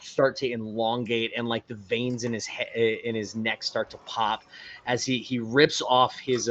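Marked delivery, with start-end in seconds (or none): none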